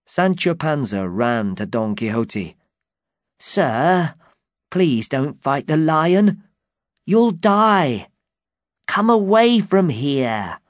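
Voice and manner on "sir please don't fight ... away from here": frightened pleading. Acted.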